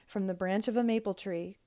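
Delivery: ordinary delivery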